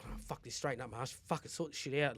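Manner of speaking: low, very soft self-talk; near breaking point. Retold or imitated. Driver pulling himself together, muttered near breaking point. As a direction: mutter a retold pep talk to yourself. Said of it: "I'm just about to go and crack".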